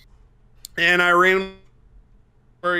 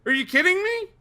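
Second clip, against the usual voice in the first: excited; 8 semitones higher than usual. Real, not acted. Hyped retelling of pit.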